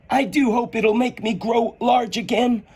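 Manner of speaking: put-on high, wondering girl's voice; young Alice. Alice shrinking, high and wondering. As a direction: marvel at the strange feeling.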